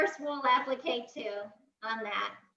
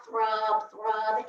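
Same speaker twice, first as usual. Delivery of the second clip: ordinary talk, nothing marked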